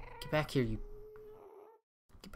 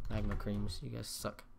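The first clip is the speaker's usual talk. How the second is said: usual speaking voice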